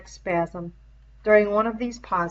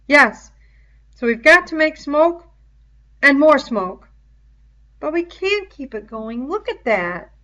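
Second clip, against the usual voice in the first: a hint of a higher boy's voice, raised; lightly voiced worried boy, louder; 8 semitones higher, 10 dB louder than usual. A narrator's reading. Worried boy telling others about the smoke, lightly raised boy voice. Boys voiced in read story.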